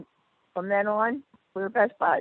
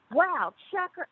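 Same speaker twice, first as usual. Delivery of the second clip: mock leering guys; 12 semitones higher, slower than usual. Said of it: "all the guys are like".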